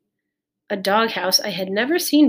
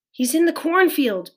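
high, raised voice; pitched-up urgency; urgent call; 8 semitones higher than usual. A narrator's reading. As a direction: tell it urgently over a storm. Pete urging mother during alarm.

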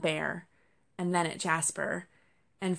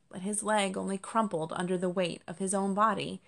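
plain reading voice

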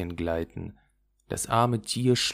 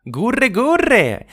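high, loud cooing wood pigeon; cooing doves; 10 dB louder than usual. Acted. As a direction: coo it out high like a dove. Waldtauben gurren. (hohes, lautes Gurren einer Waldtaube)

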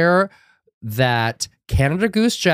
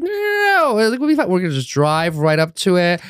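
mock grandma; 7 semitones higher than usual. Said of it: "She's like".

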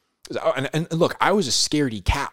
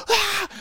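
frightened scream. Retold or imitated. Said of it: "I'm freaked out".

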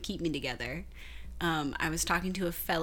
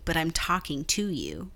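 normal talking voice

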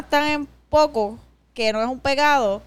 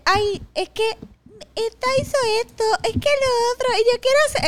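much higher whiny copycat voice; mocking imitation; 10 semitones higher than usual. [name] mocking Ariel's copying friends, high whiny voice. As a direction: mimic a whiny friend, pitched up. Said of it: "como que".

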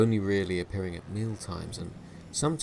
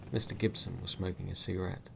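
plain reading voice

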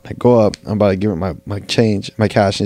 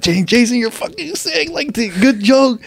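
mocking friends; 11 semitones higher than usual. Homies mocking him as joking.

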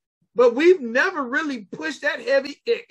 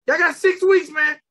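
higher, louder hype call; hype shout; 5 semitones higher, 5 dB louder than usual. Acted. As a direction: pump up the audience. Hyping the challenge deadline.

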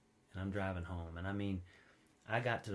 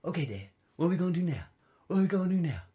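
eager dog voice; 6 semitones higher, 7 dB louder, faster than usual. Retold or imitated. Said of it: "He's just sitting there like".

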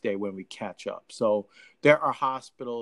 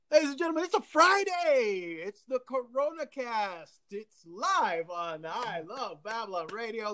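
radio show announcer; 10 semitones higher than usual. Acted. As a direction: announce the show with rising showmanship.

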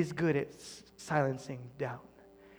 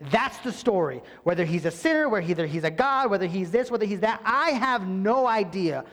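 bold defiance; 6 semitones higher, 8 dB louder than usual. Retold or imitated. Healed man defying the Pharisees, bold. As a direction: stand your ground boldly. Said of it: "And he's like".

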